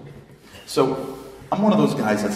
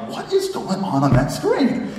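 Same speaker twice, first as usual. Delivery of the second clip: higher and slow, laughing disbelief; baffled; 9 semitones higher, 4 dB louder, slower than usual. Retold or imitated. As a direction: stare at the screen in amused confusion. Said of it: "I'm like".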